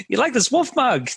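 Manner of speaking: high-pitched mock promoter; Alex Becker. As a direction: show it off like an eager hype man. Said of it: "That's a good Alex Becker impression".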